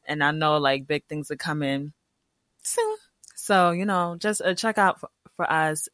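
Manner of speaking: ordinary delivery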